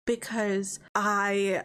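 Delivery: ordinary talk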